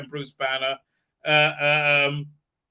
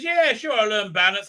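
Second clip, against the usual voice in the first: high, louder sarcastic agreement; mock dismissive reply; 5 semitones higher, 5 dB louder than usual. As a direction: toss off a hammed-up "yeah, sure". Voicing her dismissive reply to teacher.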